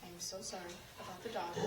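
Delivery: ordinary delivery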